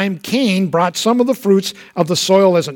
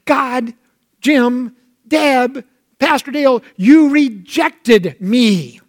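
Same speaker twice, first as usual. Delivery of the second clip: high, slow wounded cry of rejection; hurt accusation; 5 semitones higher, slower than usual. Acted. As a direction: accuse them slowly, pitched up in hurt. Voicing a hurt person's accusation.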